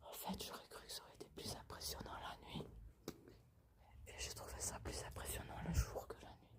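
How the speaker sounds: soft unvoiced whisper throughout; library whisper. Real, not acted. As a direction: keep it hushed, exploring in the dark. Whispering while exploring the house.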